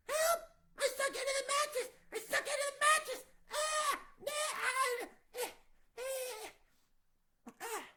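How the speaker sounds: comfy sighs turning to high, loud panicked cries for help; sinking panic. Acted. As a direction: start cosy, then yelp high and loud as you sink. Goldilocks sinking into mattress.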